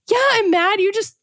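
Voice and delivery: high, sing-song mock annoyance at her daughter; mock exasperated mom. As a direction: play up being fed up with the goldfish mess.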